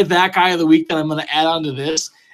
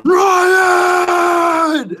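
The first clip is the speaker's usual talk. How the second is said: very high, loud bellow up the house; yelling a name; 5 dB louder than usual. Retold or imitated. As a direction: holler for the kid across the house. Dad yelling for son.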